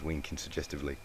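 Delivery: ordinary delivery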